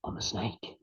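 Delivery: whispered, the snake's final line; hushed menace. Retold or imitated. Host telling the snake fable, whispering the snake's last words. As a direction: let it drop to a menacing whisper.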